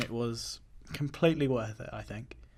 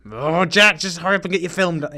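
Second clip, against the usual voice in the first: loud calling; 4 semitones higher, 13 dB louder than usual. Real, not acted. Urging Jack to hurry.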